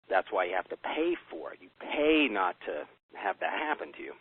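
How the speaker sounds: normal talking voice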